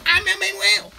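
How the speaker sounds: high, loud squeaky villain yelp; a silly put-on scare line; hammed-up mask monster cry; mock scary voice.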